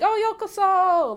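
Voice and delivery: high, loud cheery Japanese welcome; welcoming greeting. Shown as a demonstration. Demonstrating an excited shop welcome, high and loud. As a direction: sing out yokoso like greeting a guest. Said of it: "inviting you".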